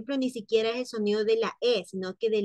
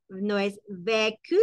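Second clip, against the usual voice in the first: ordinary talk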